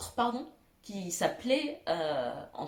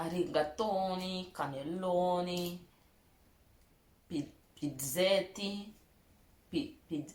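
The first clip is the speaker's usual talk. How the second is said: Italian accent; 2 semitones lower, 4 dB quieter, slower than usual. Shown as a demonstration. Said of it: "Vous avez vu mon bel accent italien".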